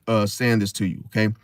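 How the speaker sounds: ordinary talk, nothing marked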